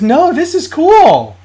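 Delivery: high, slow, mock excited girlfriend; girlfriend's delight. Girlfriend discovering his toy closet, high slow mock delight. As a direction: lightly play her, delighted and drawn out. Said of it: "And she goes".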